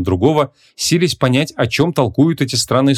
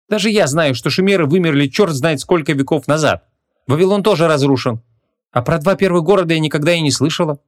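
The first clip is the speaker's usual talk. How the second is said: put-on a lightly raised young woman's voice; 6 semitones higher than usual. A narrator's reading. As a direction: voice Vanessa a bit higher, sceptical.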